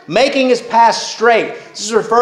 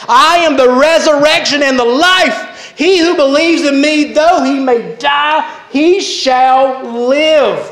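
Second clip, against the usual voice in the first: higher-pitched, impassioned proclamation of scripture; fervent declaration; 6 semitones higher than usual. Acted. Preacher repeats Jesus' great promise to the congregation, fervent declaration. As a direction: proclaim it with soaring conviction, drawing out the last words.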